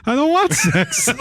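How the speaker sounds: high, slow mock whine; retold protest. Player retelling his own refusal, high mock protest. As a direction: wail it half-laughing in mock alarm. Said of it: "I was like".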